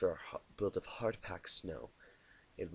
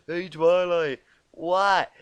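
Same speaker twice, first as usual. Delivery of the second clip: loud, high startled call; 7 semitones higher, 12 dB louder, slower than usual. Retold or imitated. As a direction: jolt round in surprise. Imagined Twilight startled.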